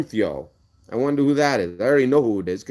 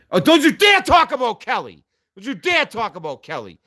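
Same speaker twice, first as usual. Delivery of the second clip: high, loud outraged shout; real high-pitched angry yelling; furious warning; 10 semitones higher, 5 dB louder than usual. Real, not acted. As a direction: explode in protective anger. Angrily warns rival off Kelly.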